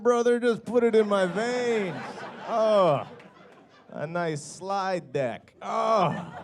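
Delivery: loud, high, slow rapture over work; hammed-up craving, moaning with delight; mock addict's ecstasy. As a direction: act hooked and desperate for a fix. Mocks work as a drug craving.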